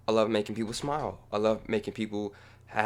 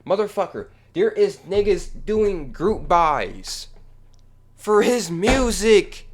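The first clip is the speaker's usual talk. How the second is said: loud and higher, slowed on each word; angry rant; 8 semitones higher, 8 dB louder than usual. Real, not acted. Ranting at the commenters about the group buys, loud and heated. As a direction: lash out at the commenters.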